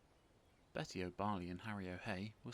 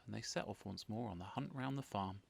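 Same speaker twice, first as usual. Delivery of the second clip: ordinary delivery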